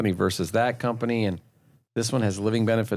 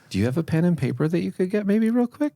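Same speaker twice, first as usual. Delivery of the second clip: much higher, timid question voice; insecure salesman; 8 semitones higher than usual. Shown as a demonstration. Insecure salesman asking a client for a pen, timid and high. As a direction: ask like you need permission. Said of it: "You'd be like".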